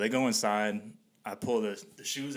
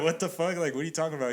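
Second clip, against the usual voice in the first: high, louder baffled outburst; retold bewilderment; 7 semitones higher, 4 dB louder, faster than usual. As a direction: imitate being completely thrown. Retold baffled reaction to homeowner.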